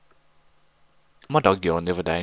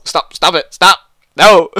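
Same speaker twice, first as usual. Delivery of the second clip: shouting; 7 semitones higher, 12 dB louder, slower than usual. Acted. Friend pleads while laughing.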